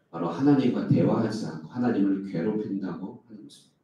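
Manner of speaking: ordinary delivery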